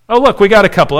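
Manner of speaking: put-on proud kids showing off their find; lightly acted eager disciple; excited disciples. Disciples voiced, excited about swords.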